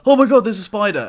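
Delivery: playing her shock at the spider; imitating a startled girlfriend. Girlfriend spotting a spider in the slipper, mock alarm. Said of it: "she was like".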